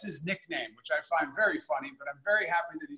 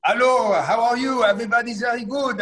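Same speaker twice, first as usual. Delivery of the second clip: loud, higher, hammed-up French accent; fake French accent; 5 semitones higher, 7 dB louder than usual. Chef greeting the call in a loud mock French accent. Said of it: "I have to have the accent".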